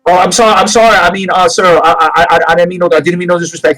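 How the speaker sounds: loud, high, hurried sorry; flustered apology. Acted. Leroy apologizing in a panic, loud and high. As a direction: scramble to apologize.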